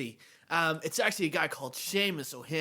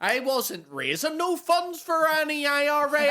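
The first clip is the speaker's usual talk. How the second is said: put-on high, loud, laughing Belfast accent; 10 semitones higher, 8 dB louder than usual. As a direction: mimic a defiant Belfast ex-gunrunner. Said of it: "Even that's a better accent".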